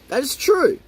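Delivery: high, mocking "That is true"; sarcastic agreement. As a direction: agree with a sneer.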